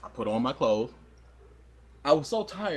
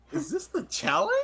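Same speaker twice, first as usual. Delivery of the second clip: high nervous voice. Retold or imitated. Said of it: "And I'm like".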